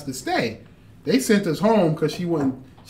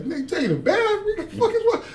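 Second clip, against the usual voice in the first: disbelief; much faster than usual. Retold or imitated. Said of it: "Yeah, like".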